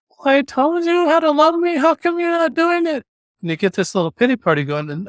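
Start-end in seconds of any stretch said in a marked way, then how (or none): 0.1-3.0 high, whiny complaining voice. Pretend. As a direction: whine it like a sulking child. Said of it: "I'm gonna get this whiny voice saying".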